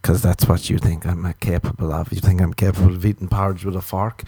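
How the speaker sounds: normal talking voice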